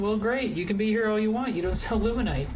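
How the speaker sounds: high-pitched exasperated griping; mock indignant. Gamer scolding lady who doesn't sell Luminite, mock indignant. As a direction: whine sarcastically at a useless shopkeeper.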